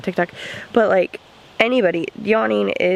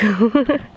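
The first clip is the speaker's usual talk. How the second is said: brief light chuckle; a quick laugh to oneself; short laugh. Real, not acted. Vlogger by a smoky fire at dusk, short laugh.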